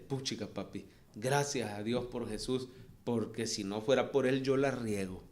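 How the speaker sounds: put-on soft, low relieved child voice; hushed thanks from a consoled boy; son's grateful words. Comforted son thanking his father, soft and low. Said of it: "en las palabras de él".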